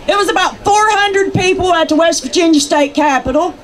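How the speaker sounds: ordinary delivery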